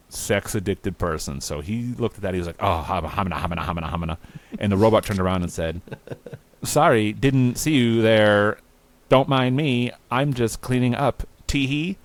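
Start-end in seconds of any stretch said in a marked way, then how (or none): 2.6-4.2 low, babbling mock drool; flustered lust. Will gawking at the maid, flustered lust. 6.6-12.0 coy maid. Acted. Robot maid caught bent over by Will, coy.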